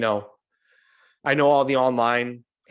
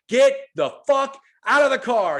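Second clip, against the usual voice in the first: much higher, loud urgent shout; retold yell; 14 semitones higher, 6 dB louder than usual. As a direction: yell it as in the frantic getaway story. Yelling at buddy to flee cab.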